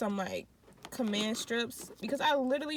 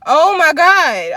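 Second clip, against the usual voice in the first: exasperated; 6 semitones higher, 20 dB louder, slower than usual. Retold or imitated.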